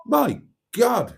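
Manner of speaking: high, swooping show of astonishment; exaggerated high-pitched surprise; mock amazement. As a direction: react as if hugely impressed, pitch leaping up. Astonished reaction to posh biscuits.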